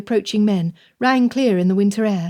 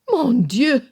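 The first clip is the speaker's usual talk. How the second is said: higher, a stricken outburst; shocked exclamation; 5 semitones higher than usual. A narrator's reading. Madame hearing the victims' fate, shocked exclamation. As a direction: gasp it out in dismay. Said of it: "Madame exclaimed".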